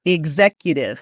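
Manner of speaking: "Executive" as ig-ZEK-yuh-tiv; voiced g and z in "Executive". Shown as a demonstration. Coach correcting the exercise X, GZ sound. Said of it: "G sound and the Z sound".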